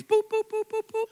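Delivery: high beeping like a checkout scanner; chirpy mock scanner beeps. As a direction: mimic the register going boop. Imitating the register scanner beeps.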